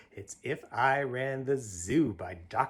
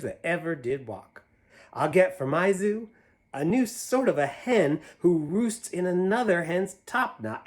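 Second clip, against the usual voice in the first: higher, louder, bouncy rhyme reading; reading to children; 5 semitones higher, 5 dB louder, slower than usual. Acted. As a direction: read it big and playful for a child. Reads picture book aloud.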